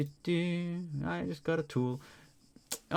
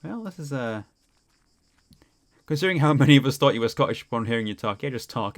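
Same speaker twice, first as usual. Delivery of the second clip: ordinary talk, nothing marked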